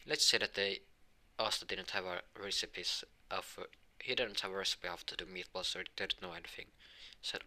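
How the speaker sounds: normal talking voice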